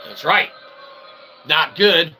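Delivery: ordinary talk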